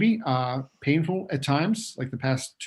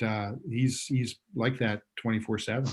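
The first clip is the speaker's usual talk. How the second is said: ordinary talk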